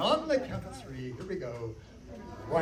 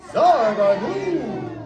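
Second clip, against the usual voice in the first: very slow, louder spell word with a laugh; laughing magic word; 5 semitones higher, 8 dB louder, much slower than usual. Acted. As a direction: draw the spell out grandly, laughing.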